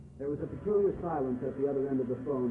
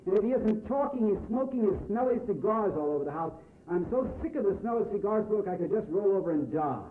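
put-on raised, complaining young woman's voice, higher and a bit louder; Franny, aggrieved sister; 6 semitones higher, 5 dB louder than usual. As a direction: pour out indignant grievances about her brother.

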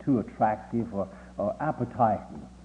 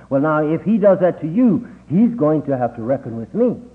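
protective mother; 4 semitones higher, 7 dB louder than usual. Retold or imitated.

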